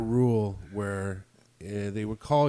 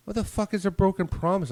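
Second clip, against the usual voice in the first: higher, louder outraged repeat; exasperated; 9 semitones higher, 4 dB louder than usual. Retold or imitated. To the supervisor, louder exasperated repeat. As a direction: throw the question back in disbelief. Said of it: "I was like".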